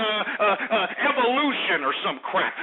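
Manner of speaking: normal talking voice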